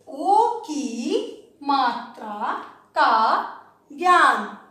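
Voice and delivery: ओ की मात्रा का ज्ञान stretched slowly as one phrase; drawn-out phrase. Shown as a demonstration. Teacher to class, repeating the title as one slow phrase. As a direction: let ओ की मात्रा का ज्ञान flow together, very slowly. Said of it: "repeats title as one phrase".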